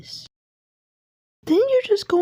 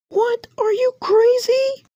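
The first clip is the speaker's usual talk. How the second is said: shocked outcry; 6 semitones higher, slower than usual. Acted. Outraged at the other.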